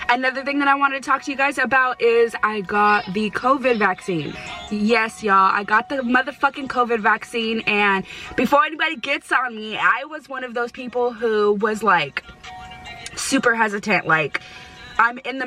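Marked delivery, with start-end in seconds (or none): none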